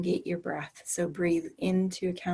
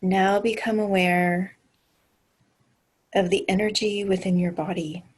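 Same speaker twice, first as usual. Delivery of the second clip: guided meditation. Acted. Leads a grounding meditation.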